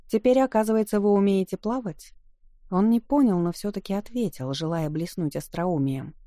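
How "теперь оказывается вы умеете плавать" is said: louder and higher, teasing for the room; said for all to hear. Acted. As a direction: project so every guest hears. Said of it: "сказала она так, чтобы её услышали кругом".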